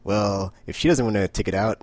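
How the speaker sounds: loud, cocky laughing taunt; gleeful mock taunt. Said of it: "Petrichor, the transgender lady, is like".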